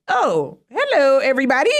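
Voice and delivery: high, loud surprised greeting; caught off guard. Retold or imitated. Candidate greeting twenty-six students, startled high and loud.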